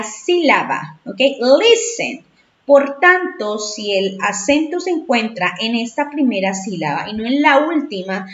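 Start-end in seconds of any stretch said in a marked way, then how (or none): 1.6-2.2 "Listen" with LIS punched high and louder-voiced; stress on first syllable. Shown as a demonstration. Teacher shows students where the accent sits, "Listen" stressed on LIS. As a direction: hit the first syllable of "Listen", let the second fall. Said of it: "el acento lo encontramos en la primera".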